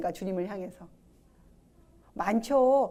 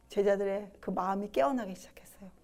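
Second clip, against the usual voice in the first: usual speaking voice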